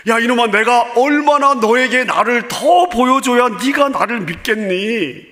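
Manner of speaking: mock-scold like a frustrated father; scolding God. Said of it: "하고 신란하거나 책망하지 않습니다". (꾸짖는 하나님 목소리로, 높게 나무라듯)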